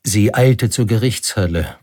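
usual speaking voice

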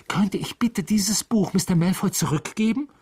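eager hurried question; hasty. A narrator's reading. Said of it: "sagte er hastig".

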